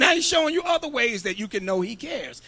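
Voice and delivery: loud, high, shouted preaching; fiery shouted sermon; preaching at full force. Acted. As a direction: preach it to the rafters.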